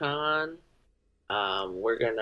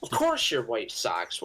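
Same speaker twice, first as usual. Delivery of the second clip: usual speaking voice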